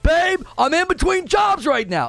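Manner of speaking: high mock shout of the excuse; boyfriend excuse. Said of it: "and you're like".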